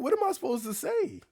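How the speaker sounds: high incredulous what am I supposed to say. Retold or imitated. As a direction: throw it back in disbelief. Incredulous retort to cashier.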